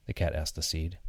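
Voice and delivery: usual speaking voice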